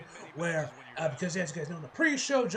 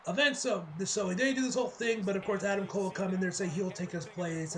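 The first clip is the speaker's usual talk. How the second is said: ordinary talk, nothing marked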